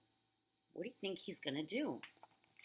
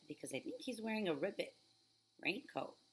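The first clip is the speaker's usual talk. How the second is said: ordinary delivery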